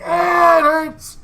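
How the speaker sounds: high, loud comic wail; pained cry. Acted. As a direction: cry out as if hurt on landing. Voices characters landing on faces.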